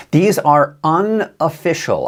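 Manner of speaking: "unofficial" drawn out slowly for emphasis; stress on UNOFFICIAL. Real, not acted. Forecaster cautioning viewers about snow totals, stress on "unofficial". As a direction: lean slowly on each part of "unofficial". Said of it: "I wanna stress the word unofficial".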